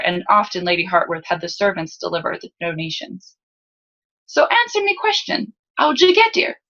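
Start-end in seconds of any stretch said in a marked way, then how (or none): 4.3-6.6 much higher-pitched lower-class Salzen lad; high, boyish lower-class Salzen accent. A narrator's reading. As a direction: question her in a high Salzen street accent. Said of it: "belonged to the lower class of Salzen".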